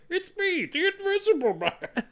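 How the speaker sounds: high, reedy, theatrical; old-movie Invisible Man. Retold or imitated. Invisible man announcing himself, high and reedy. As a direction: announce yourself like a B-movie villain. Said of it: "original Invisible Man from those old movies".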